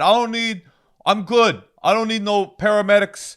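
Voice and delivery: high, loud mock Italian stepdad. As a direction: imitate a stubborn Italian stepdad waving off help. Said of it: "your Italian stepdad".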